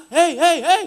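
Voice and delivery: mock shout across distance; calling out. Shown as a demonstration. Belitung neighbours call between distant houses, mock shout. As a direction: call out hei to a far house. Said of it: "nggak ada yang bisik-bisik. Harus teriak".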